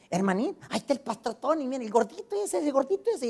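put-on high, loud, tittering old church lady; excited gossip. One widow pointing out the chubby pastor to another, excited gossip. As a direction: excitedly point someone out to a friend.

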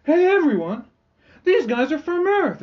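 high, loud call to the room; excited announcement. Retold or imitated. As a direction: shout the news to everyone. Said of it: "they're like".

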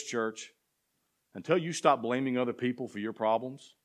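ordinary talk, nothing marked

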